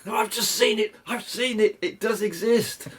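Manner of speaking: high, breathless with awe; awestruck excitement. Retold or imitated. Rod on the phone describing the giant carp, high and awestruck. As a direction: gasp it out like he's seen a monster. Rod raving about the huge fish.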